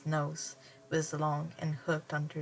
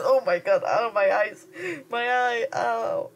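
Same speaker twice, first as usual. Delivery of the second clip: laughing; 7 semitones higher, 9 dB louder than usual. Real, not acted. Reader laughs, pain in eye.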